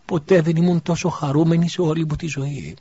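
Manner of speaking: overwhelmed with happiness; tearful joy. A narrator's reading. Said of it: "σφουγγίζοντας τα δάκρυά της". (χαρά μέσα από δάκρυα, πιο ψηλά)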